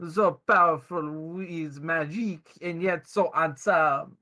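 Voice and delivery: put-on slow, sultry French accent; saucy French accent.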